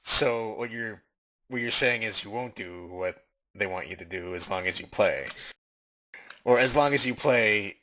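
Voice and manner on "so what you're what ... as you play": normal talking voice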